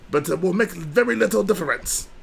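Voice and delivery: lightly voiced alien junk dealer; a hint of a raspy alien trader; Watto voice. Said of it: "Watto says that".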